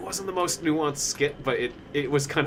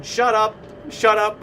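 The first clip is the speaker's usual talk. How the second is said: louder, higher mock kid blurting; special kid's outburst; 7 semitones higher, 4 dB louder, slower than usual. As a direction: imitate the kid's abrupt interruption. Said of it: "he has this very special condition".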